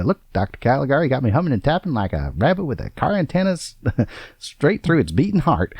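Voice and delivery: high, swooping movie-monologue impression; Gus Pratt. Quoting a cannibal patient's strange monologue, hammed-up character voice. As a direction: play a weird asylum cannibal, pitch swinging up high. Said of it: "monologues that culminate in these strange expressions".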